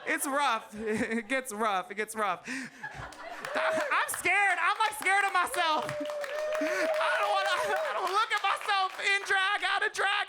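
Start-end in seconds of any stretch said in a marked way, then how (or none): 2.5-10.3 high, laughing mock terror; mock fear. Comic queen shrieking in mock fright at herself. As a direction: squeal it as if scared of yourself.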